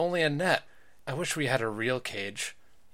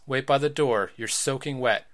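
ordinary delivery

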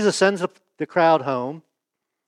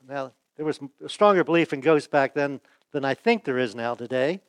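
ordinary talk, nothing marked

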